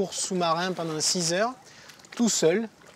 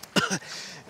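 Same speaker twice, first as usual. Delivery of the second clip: soft unvoiced cough; 5 dB quieter than usual. Real, not acted. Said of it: "J'ai encore un moustique dans la gorge".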